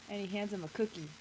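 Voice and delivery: usual speaking voice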